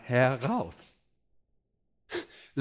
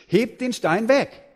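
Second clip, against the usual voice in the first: higher, slow, louder order; firm command; 6 semitones higher, 4 dB louder, slower than usual. Acted. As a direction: give the order deliberately. Jesus orders stone removed.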